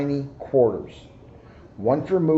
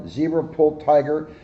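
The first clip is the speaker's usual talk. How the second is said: ordinary delivery, nothing marked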